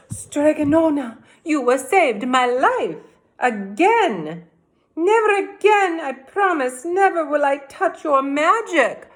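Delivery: cried out. A narrator's reading. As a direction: promise it with relief and fervour. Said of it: "cried Big Anthony".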